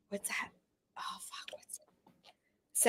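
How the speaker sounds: real whispered self-talk; very soft whisper while trying to remember; whispering to oneself. Real, not acted. Searching memory, muttering to herself.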